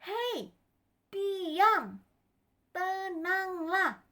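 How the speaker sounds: high, loud call with falling endings; commanding shout. Acted. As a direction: order the storm to be still, word by word. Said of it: "Tuhan Yesusnya teriak".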